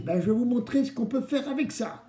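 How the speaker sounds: usual speaking voice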